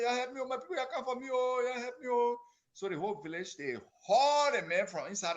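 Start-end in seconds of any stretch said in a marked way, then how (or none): 0.0-2.4 high pleading call for help; frantic cry for help. Acted. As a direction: beg the village for help. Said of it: "calling for help frantically".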